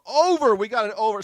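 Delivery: high, loud burst; excited call-out. Real, not acted. Streamer announcing a bet from the chat, excited call. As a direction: shout it like a winning bid.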